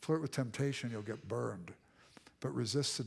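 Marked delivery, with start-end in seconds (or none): none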